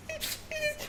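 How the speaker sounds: short high-pitched laugh; a squeaky laugh at yourself. Real, not acted. Laughing at the memory of crying, high-pitched.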